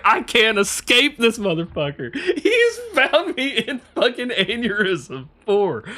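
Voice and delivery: high, slowing, laughing retold disbelief. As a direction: laugh through it, mock despair. Retold disbelief at being found.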